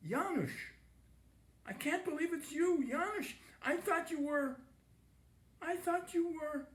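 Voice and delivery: breathless, stunned gasp, high; father gasping on seeing his dead son. A narrator's reading. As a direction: gasp it out in disbelief. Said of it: "he gasped out".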